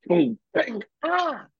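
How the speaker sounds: high mock bang and a yelp; imitated crash and yelp. Retelling the shower rod hitting the drunk kid, mock crash and yelp. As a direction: act out the rod falling and his cry.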